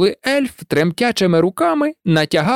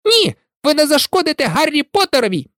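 high squeaky elf cry, fast; fiercely shield Harry; shouting house-elf; 10 semitones higher, faster than usual. A narrator's reading. Dobby crying out to stop Malfoy, protective. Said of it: "Доббі крикнув".

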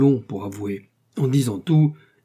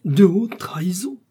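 high, breathless whispered horror; shocked disbelieving whisper; aghast whisper; 9 semitones higher, 5 dB louder than usual. A narrator's reading. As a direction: whisper it, stunned by the charge. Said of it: "épouvanté".

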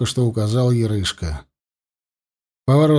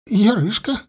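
высоко, со шмыганьем; мужичок называет себя, шмыгнув носом; шмыгая носом; 9 semitones higher than usual. A narrator's reading. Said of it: "шмыгнул носом мужичок". (шмыгая носом)

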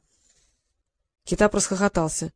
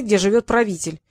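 ordinary delivery

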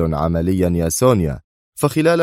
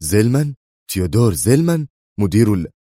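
astonished; 3 semitones higher than usual. A narrator's reading. Man hears a startling name from Sonia, astonished. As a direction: repeat the name in rising astonishment. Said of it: "قال في دهشة".

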